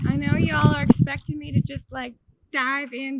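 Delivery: shouting over the chill; loud and high. Real, not acted. Shouting while in cold water.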